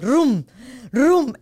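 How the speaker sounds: imitated engine rumble; engine revs. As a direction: rev the motor twice. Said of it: "I was like".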